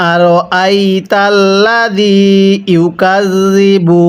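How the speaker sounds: drawn-out Arabic recitation, slow; slow Quran recitation. Shown as a demonstration. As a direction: recite slowly for students to repeat. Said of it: "মিলিয়ে পড়ুন।". (ধীরে কুরআন তিলাওয়াত)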